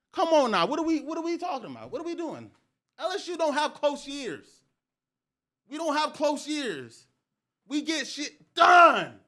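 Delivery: high-pitched protest building to a loud finish; indignant boasting. Retold or imitated.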